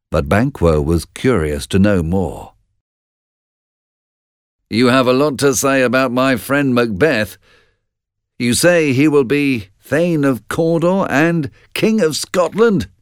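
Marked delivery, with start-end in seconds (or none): 4.7-12.9 high, insistent challenge to the witches; bold. A narrator's reading. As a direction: speak up boldly in a raised pitch. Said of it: "he said boldly".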